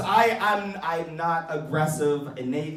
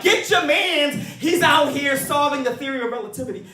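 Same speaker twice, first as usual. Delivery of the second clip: loud, high callout; hammed-up admiring shout; mock proud yell; 10 semitones higher, 6 dB louder than usual. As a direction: shout it like bragging about him. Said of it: "It was just like".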